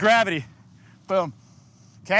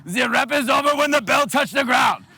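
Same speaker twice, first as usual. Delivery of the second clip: raised-pitch mock German-Russian yell; bad German-Russian accent; 4 semitones higher than usual. Coach yelling a safety rule at lifters, bad German-Russian accent. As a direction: bark it in a hammed-up, vaguely German or Russian accent. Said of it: "say it kind of in a really bad accent".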